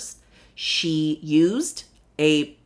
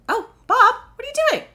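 high, louder scolding call; calling the cat; 5 dB louder, faster than usual. Real, not acted. Owner calling her cat off the keyboard, scolding.

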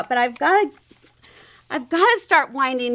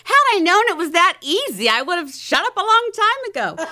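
higher amused retort, a touch louder; laughing reply; 6 semitones higher, 3 dB louder, faster than usual. Retold or imitated. Host laughing as she recalls answering her daughter, amused. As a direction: toss it back with a laugh. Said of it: "And I said".

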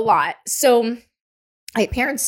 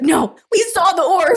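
laughing insistence; 9 semitones higher, 5 dB louder than usual. Retold or imitated. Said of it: "He's just like".

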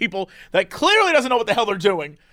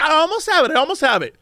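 a bit higher, fumbling insistence; lightly voiced flustered woman; 7 semitones higher than usual. The woman insisting to the agent, slightly higher. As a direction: keep stalling, a hint of panic. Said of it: "She's like".

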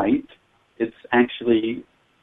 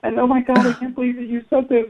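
imitating her excited gushing, much higher; excited retelling; 12 semitones higher than usual. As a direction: replay the woman's amazed rush of words. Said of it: "and she goes".

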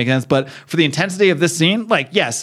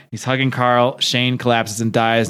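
ordinary talk

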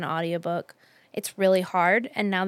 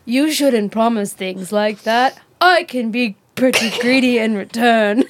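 lightly voiced man; 6 dB louder than usual. Reading a book line aloud in a mock male voice. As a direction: read the line as a lightly played romantic hero. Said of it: "is this a dude talking".

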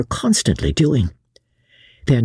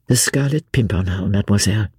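lightly voiced gentleman, lower; earnest young Englishman; 8 semitones lower, faster than usual. A narrator's reading. Gentleman explains his leader's name to mademoiselle, low and earnest. Sir Andrew answers reverently.

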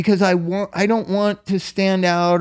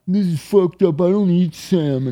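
lightly voiced spoiled patient griping; mock whiny complaint. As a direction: imitate a kid whining about food. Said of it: "you gotta make a".

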